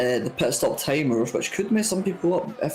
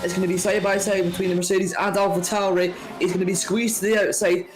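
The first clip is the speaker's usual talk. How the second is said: higher, excited live play-by-play; motorsport commentator; 6 semitones higher than usual. Real, not acted. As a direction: call the overtake as it happens.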